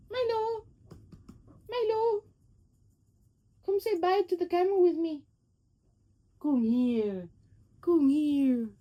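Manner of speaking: high coaxing call to the cat; calling pet. Real, not acted. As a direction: call the cat over sweetly.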